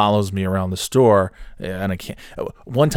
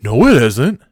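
loud, abrupt contradiction; imitated touchy outburst; mock indignation; 3 semitones higher, 8 dB louder than usual. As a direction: snap back like the temperamental coworker. Retold snappish contradiction of sympathy.

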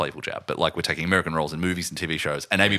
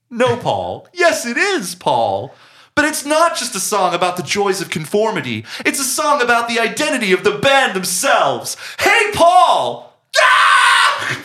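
manic high rant building to a shout; hammed-up ranting, climbing higher and louder into a scream; imitated rant; 14 semitones higher, 6 dB louder, slower than usual. Fan re-enacting the killer's rant about a band, building to a shout. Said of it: "his fucking rant to Jared Leto".

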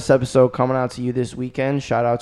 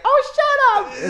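very high, slow mock female shriek; annoyed mom; 4 dB louder, much slower than usual. Mom and aunt snapping at the teasing dad, shrill mock. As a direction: squeal it like an exasperated aunt. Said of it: "my mom and my aunt would be like".

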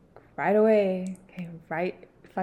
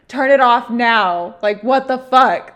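louder and slow, furious; angry demand; 4 semitones higher, 10 dB louder, slower than usual. Retold or imitated. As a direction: bark the order at him. Angry girlfriend ordering boyfriend.

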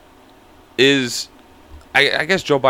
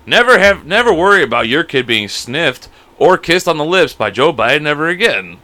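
ad read; 2 semitones higher, 4 dB louder than usual. Acted. Reads mock commercial.

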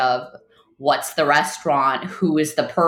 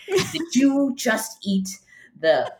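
serious French manager; 3 semitones higher, slower than usual. Retold or imitated. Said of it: "he's French and he's very, very serious".

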